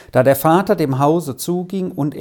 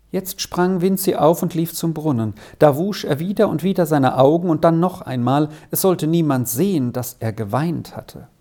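plain reading voice

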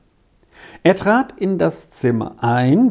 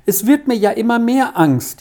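a mild lament, growing more fearful; wailing; 7 semitones higher than usual. A narrator's reading. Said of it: "jammerte Elsa". (Elsa jammert ängstlich zu Max)

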